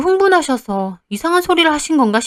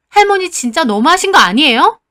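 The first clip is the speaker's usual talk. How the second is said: higher, louder outraged protest; angry protest; 6 semitones higher, 5 dB louder than usual. Acted. Granddaughter snaps back at grandma over abalone, angry.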